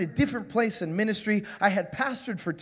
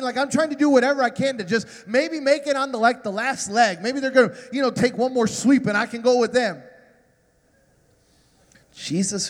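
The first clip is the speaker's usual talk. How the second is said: usual speaking voice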